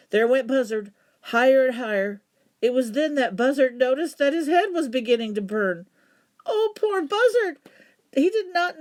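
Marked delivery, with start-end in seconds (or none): 6.4-8.8 high, loud pitying cry; pitying lament. A narrator's reading. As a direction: cry out in pity. Narrator laments Buzzard.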